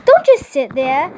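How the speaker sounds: hammed-up impatient stylist; bossy impatience. A narrator's reading. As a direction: boss the assistant in bad French. Bossing teddy bear in fake accent.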